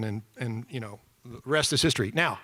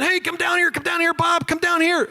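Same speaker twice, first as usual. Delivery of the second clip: high, loud calling out; yelling; 4 dB louder than usual. Retold or imitated. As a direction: shout through the bars to get him over. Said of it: "we're yelling and screaming".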